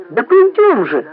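exasperated high-pitched urging; despairing cry. A narrator's reading. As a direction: cry out in desperation to get going. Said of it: "в отчаянии вскричал Семенон".

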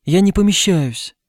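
put-on high, plaintive little girl. A narrator's reading. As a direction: complain in a high girlish voice. Narrator voices the small girl.

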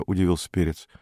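plain reading voice

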